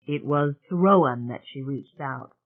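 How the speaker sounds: usual speaking voice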